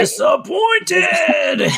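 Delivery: high, slow drawn-out shout; hammed-up disappointed wail; mock outrage. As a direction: stretch it out in mock horror. Mock outraged echo of friend.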